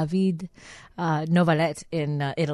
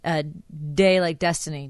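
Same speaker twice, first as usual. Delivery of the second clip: ordinary talk, nothing marked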